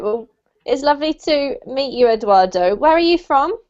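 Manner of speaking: ordinary talk, nothing marked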